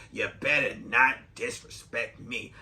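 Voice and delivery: mild imitation of the older woman; smoker's voice. Shown as a demonstration. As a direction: demo how the chain-smoking coworker talks. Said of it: "she talks like this 'cause she smoked a lot of cigarettes".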